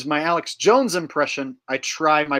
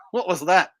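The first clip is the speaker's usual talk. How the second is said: usual speaking voice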